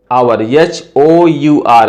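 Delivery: slow letter-by-letter spelling of H O यू आर. Shown as a demonstration. Teacher spelling out आवर for students, slowly. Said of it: "आवर का उच्चारण जो है आवर आ रहा है".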